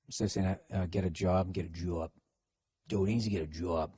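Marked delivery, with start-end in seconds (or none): 1.5-2.1 "job" with a broad Boston vowel, low and soft; Boston accent. Shown as a demonstration. Host contrasting two sayings of job, Boston accent. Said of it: "working on your Boston accents". 2.9-3.9 low Boston "job" with a broad vowel; Boston accent. Shown as a demonstration. Host demonstrating the word job, Boston accent. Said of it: "working on your Boston accents".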